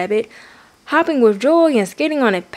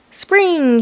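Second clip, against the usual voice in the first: ordinary delivery, nothing marked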